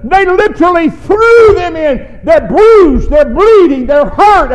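loud, rising preacher's build; preaching at full force. Acted. Preacher building to the congregation, full force. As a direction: drive it home like a Sunday preacher.

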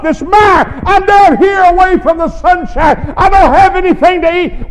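loud, high, whining complaint to God; complaining prayer. Pretend. Pastor voicing a believer complaining to God from prison. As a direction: gripe at God like you've been wronged. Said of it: "We would be complaining".

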